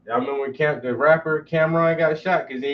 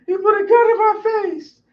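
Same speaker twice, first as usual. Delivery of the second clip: hysterical sister; 5 dB louder than usual. Retold or imitated. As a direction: play the crying sister gasping it out. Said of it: "called me crying, hysterical".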